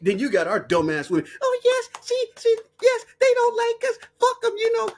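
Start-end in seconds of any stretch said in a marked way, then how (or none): 1.4-4.9 much higher, squeaky mock of a gullible woman. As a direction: sneer as you squeak it out. Mocking "dumbass women.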